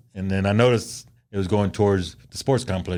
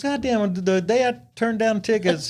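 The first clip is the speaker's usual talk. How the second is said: imitating wife; 12 semitones higher than usual. Wife voiced in the story.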